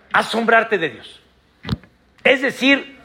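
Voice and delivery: ordinary delivery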